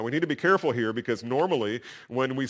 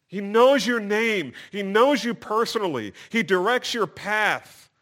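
higher, loud, slow proclamation; emphatic preaching; 10 semitones higher, 7 dB louder than usual. Real, not acted. Preacher assures the congregation God knows them, emphatic. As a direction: preach it out with force.

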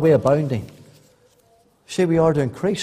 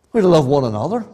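ordinary talk

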